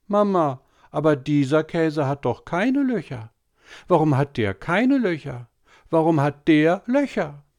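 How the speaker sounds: put-on high, whining little boy voice; whiny boy. A narrator's reading. Tobi whining to mama at dinner, high and whiny. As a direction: whine like a small boy pestering mama.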